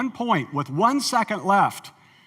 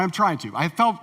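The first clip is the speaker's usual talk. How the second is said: usual speaking voice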